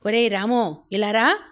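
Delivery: a loud, sharp call across the house. A narrator's reading. Bedridden brother loudly summoning Ramu to his bed, firm. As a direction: call him over firmly and loud. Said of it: "గట్టిగా పిలిచాడు".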